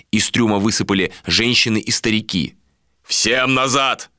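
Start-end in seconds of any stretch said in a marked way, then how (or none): 3.0-4.1 slow, raised command; commanding order. A narrator's reading. Captain sends women and old men back, commanding. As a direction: order them back, drawing it out. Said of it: "приказал Иштар".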